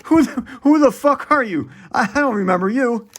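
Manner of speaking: high, loud startled cry; hammed-up high shocked shout; shocked morning-after yell. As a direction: recoil in mock horror. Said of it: "It's like".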